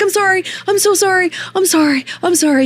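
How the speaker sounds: high, pleading apology to the horse. Retold or imitated. To the upset horse, high pleading sorry. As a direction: beg forgiveness in a high voice. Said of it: "And I'm like".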